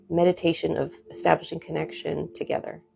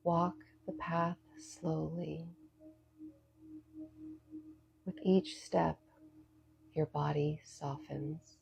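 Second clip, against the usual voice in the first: soft spa whisper; 11 dB quieter than usual. Acted. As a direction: lead them gently into calm. Leads a guided meditation.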